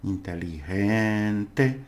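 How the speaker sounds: "Inteligente" slowly, with "gen" drawn out; stressed syllable. Shown as a demonstration. Teacher shows learners where stress falls, syllable drawn out. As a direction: lean long on "gen" in "Inteligente". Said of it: "the pronunciation is stress, where you do it".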